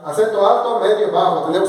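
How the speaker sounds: normal talking voice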